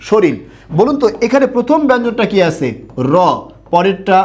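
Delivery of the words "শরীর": শরীর as "shoril", final r becomes l; common mispronunciation. Shown as a demonstration. Teacher repeats the common pronunciation, saying shoril. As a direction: end শরীর on an l sound. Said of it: "কি উচ্চারণ করি?".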